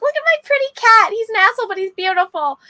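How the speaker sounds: high, loud gushing over a pretty pet; gushing proud owner. Retold or imitated. Owner showing off her cat online, high gushing. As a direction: coo about your beautiful cat.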